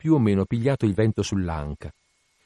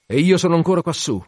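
raised cry from aloft; calling out; 7 semitones higher, 5 dB louder, faster than usual. Acted. Ahab aloft calling out, raised voice. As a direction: call it out loudly from on high.

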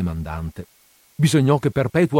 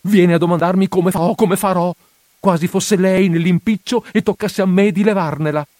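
higher and louder, peevish complaint; irritated voice; 7 semitones higher, 4 dB louder than usual. Acted. Priest grumbling at his housekeeper's advice, irritated. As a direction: snap back, fed up with her advice. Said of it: "con voce stizzosa".

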